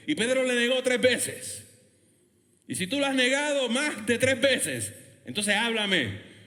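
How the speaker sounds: high, loud, driving sermon; fervent preacher proclaiming mercy; full-force preaching. Real, not acted. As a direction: drive it home to the whole congregation.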